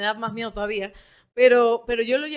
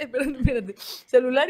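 laughing mimicry; 6 dB louder than usual. Mocking influencers pausing their crying.

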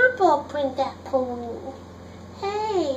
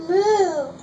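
louder, higher mooing again; cow moo; 5 semitones higher, 5 dB louder than usual. Acted. Reading to little sister, a mooing cow. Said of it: "He can sound like a cow".

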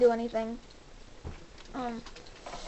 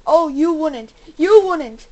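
real shouted warning, louder on the repeat; defiant shout; 2 semitones higher, 12 dB louder, slower than usual. Real, not acted. Player warning off an attacker in the game, loud. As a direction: shout it down as it comes at you.

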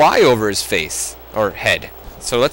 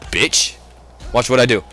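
usual speaking voice

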